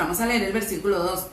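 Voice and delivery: ordinary delivery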